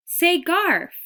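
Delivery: high, loud squeaky shout; top of his voice. A narrator's reading. Desperate mouse yells to the unhearing boy, high and loud. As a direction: yell it with all a little mouse has. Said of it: "at the top of his mouse voice".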